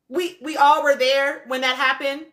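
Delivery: ordinary talk, nothing marked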